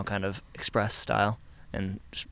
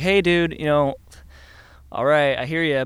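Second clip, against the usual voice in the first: producer's hearty reply; 5 semitones higher, 11 dB louder, slower than usual. Retold or imitated. Producer voiced, retold reply.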